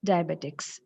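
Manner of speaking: Diabetics as DIE-betics, three syllables; common mispronunciation. Shown as a demonstration. Teacher repeating the error, Diabetics as DIE-betics. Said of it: "That is the mispronunciation".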